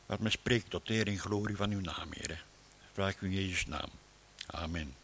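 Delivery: soft, hushed prayer voice; quiet reverent opening prayer. Acted. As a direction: pray quietly and humbly to God. Opening prayer before sermon.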